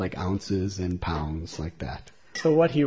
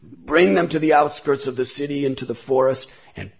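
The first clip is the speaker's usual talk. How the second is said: loud, commanding king's order; angry order; 3 semitones higher, 7 dB louder than usual. A narrator's reading. The king, very upset, orders the guards with a loud angry command. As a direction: bark it out as an enraged ruler.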